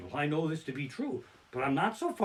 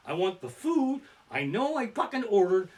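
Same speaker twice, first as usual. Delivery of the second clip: normal talking voice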